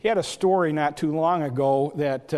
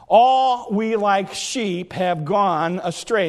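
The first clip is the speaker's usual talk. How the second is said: slow, ringing verse with ALL drawn out; deliberate preacher's cadence; emphatic scripture repeat; 2 semitones higher, slower than usual. Real, not acted. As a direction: proclaim it slowly to the congregation.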